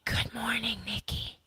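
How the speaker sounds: soft hushed whisper; a quiet whispered greeting. Real, not acted. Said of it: "Why, why are we whispering?".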